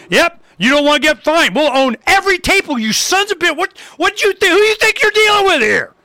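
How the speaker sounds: cocky mock challenge, pitched high; mock taunting retort. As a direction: jeer at them like you own the room. Mocking retort to convention officials.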